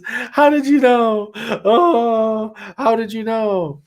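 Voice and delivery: high, drawn-out mock sobbing; imitated crying. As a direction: wail the question as if bursting into tears. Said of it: "she's just crying and crying".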